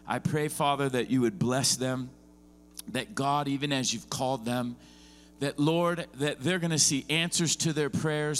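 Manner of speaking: lifted, earnest pastoral prayer; spoken blessing over the church; closing prayer. Real, not acted. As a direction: pray over them with rising fervour.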